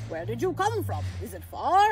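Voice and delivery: character voice asking questions; Santa. A narrator's reading. Santa asking where the pixie came from, curious. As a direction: question the newcomer in a big voice.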